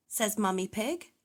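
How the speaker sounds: ordinary delivery, nothing marked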